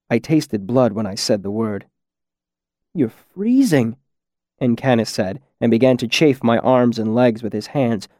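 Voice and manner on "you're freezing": slow, higher-pitched concern; concerned rescuer. Acted. Masked man finds the kid freezing, concerned. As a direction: draw it out with concern, pitch raised. Incanus worried over frozen kid.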